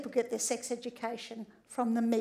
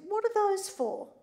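put-on high curious boy; lightly voiced small boy; a hint of a child asking; child's voice; 7 semitones higher than usual. Child's question retold.

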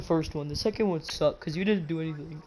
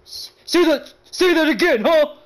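loud, raised challenge; mock threatening shout; 8 semitones higher, 8 dB louder than usual. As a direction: play at daring them to repeat it. Challenges the other in mock fight.

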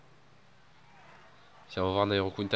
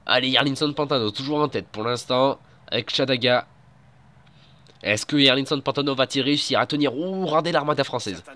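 loud, high finale race call; excited sports commentary; 3 semitones higher, 4 dB louder than usual. Acted. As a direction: build to the final climb. Play-by-play commentary.

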